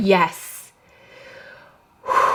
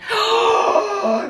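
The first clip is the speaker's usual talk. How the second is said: high shocked gasp; real startled gasp; sharp gasp; 12 semitones higher than usual. Real, not acted. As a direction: suck in air at the card. Losing card revealed.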